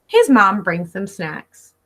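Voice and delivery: ordinary delivery, nothing marked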